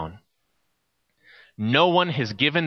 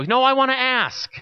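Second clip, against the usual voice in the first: put-on a kid refusing to leave; insistent little boy; 10 semitones higher than usual. Boy insists to his mom.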